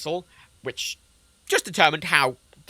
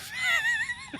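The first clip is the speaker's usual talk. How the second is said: surprised shrieking laugh; high shriek laugh; 7 dB quieter than usual. Real, not acted. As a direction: let out a shrill burst of laughter. Reacts after the mishap.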